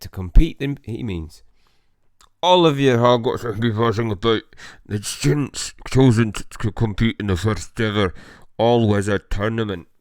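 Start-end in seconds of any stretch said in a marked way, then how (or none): 2.4-9.9 louder Scottish professor announcing the tournament; Scottish accent. Acted. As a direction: proclaim it with a Scottish lilt. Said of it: "a Scottish accent".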